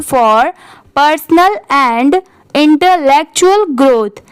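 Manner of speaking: very slow, word by word with pauses; slow pausing read-aloud. Shown as a demonstration. Teacher reads paragraph aloud for students, very slow and measured. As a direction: read it slowly, pausing at every phrase. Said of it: "तो चलिए इसे ऐसे पढ़ेंगे".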